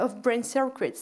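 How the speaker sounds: ordinary delivery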